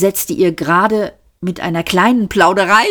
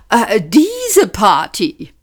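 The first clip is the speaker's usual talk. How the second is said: slow, higher, caught-out hesitation; guilty startle; 4 semitones higher, 3 dB louder, much slower than usual. A narrator's reading. Zaphod admits he knows the party, guiltily startled. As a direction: stretch it out like someone found out. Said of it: "mit schuldbewusstem Erschrecken".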